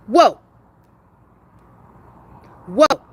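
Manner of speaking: loud sudden startled whoa; real startled exclamation. Real, not acted. Startled by the dog.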